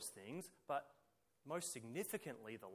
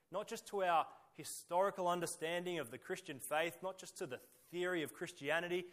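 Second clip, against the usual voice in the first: ordinary talk, nothing marked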